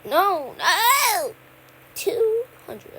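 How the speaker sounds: high loud panicked no; panicked shout. Real, not acted. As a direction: yell in sudden panic. Panicked yelling at game.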